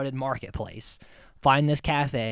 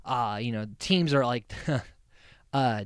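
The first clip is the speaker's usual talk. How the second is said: usual speaking voice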